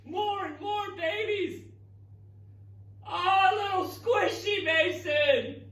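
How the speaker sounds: high, slow, tender coo; awed cooing. Real, not acted. Teacher cooing to Mason over the baby octopuses. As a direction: coo over the tiny babies.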